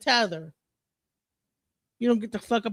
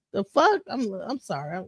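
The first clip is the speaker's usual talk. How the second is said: ordinary talk, nothing marked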